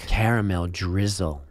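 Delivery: low, soft seductive purr; a hammed-up sultry ad read; sexy voice. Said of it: "do it in a sexy voice".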